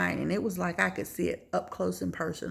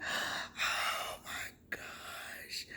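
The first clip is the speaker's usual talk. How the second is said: drawn-out breathy whisper, near tears; acted horror; 9 dB quieter, much slower than usual. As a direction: breathe it out slowly as if horrified. Retells horror at the threat.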